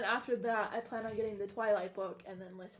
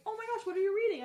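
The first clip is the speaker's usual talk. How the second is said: mock eager; 9 semitones higher, 3 dB louder, faster than usual. Retold excited question to kid.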